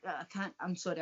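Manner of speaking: ordinary delivery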